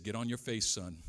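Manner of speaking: low-pitched, understated fatherly command; lightly voiced stern father; stern dad. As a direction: imitate a father's low, firm order. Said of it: "And he said".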